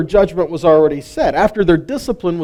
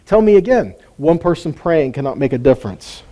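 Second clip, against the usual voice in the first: usual speaking voice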